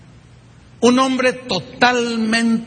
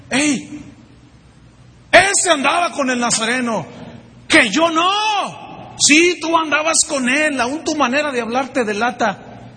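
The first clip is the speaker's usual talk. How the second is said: higher, pointed accusation; accusing bystander; 6 semitones higher than usual. Acted. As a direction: call him out, sure of it. Otro acusa a Pedro. (acusación aguda y segura)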